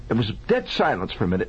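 ordinary delivery

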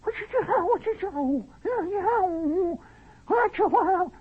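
extremely high bird-like squawk; strange medieval bird. Acted. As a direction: voice placed far above the head. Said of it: "strange medieval bird is doing it".

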